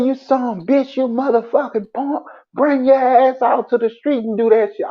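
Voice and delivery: imitating an angry cop; retold cop yelling. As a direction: bark it like a riled-up cop.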